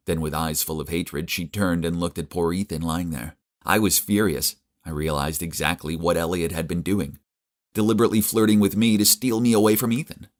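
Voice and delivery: ordinary delivery, nothing marked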